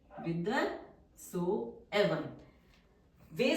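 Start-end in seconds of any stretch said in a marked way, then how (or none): 0.1-2.2 very drawn-out, syllable by syllable; slow dictation. Shown as a demonstration. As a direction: stretch it out for the class to write. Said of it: "teacher dictates word slowly". (बहुत धीमे खींचकर बोलना)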